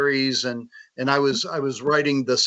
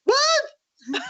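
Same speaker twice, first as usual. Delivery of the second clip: much higher, squeaky stunned "what"; stunned disbelief; much slower than usual. Retold or imitated. To the author, the co-author in high shocked disbelief.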